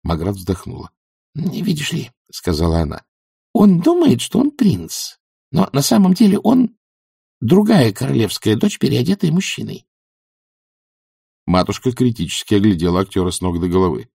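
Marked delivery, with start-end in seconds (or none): 1.3-2.1 high, soft voice; timid, higher-pitched explanation; explaining young witch. A narrator's reading. Magrat patiently explains to Granny. 3.5-9.9 put-on high, girlish voice; eager, high-pitched explanation; explaining young witch. A narrator's reading. Magrat explains the play to Granny.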